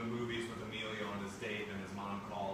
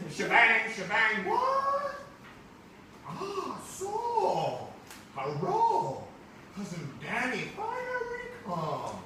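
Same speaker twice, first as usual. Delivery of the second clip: high, loud cartoonish put-on cousin voice; exaggerated high cousin voice; 11 semitones higher, 9 dB louder, slower than usual. Teacher reading the comic to the class, high cartoonish cousin voice. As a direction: play the visiting cousin big and squeaky.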